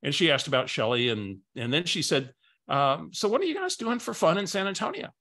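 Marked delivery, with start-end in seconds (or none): 3.1-5.1 higher, chatty friendly question; Barbara's voice. Retold or imitated. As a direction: play the chatty friend asking. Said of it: "I gotta use her voice".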